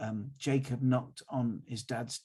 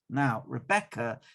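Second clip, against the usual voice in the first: plain reading voice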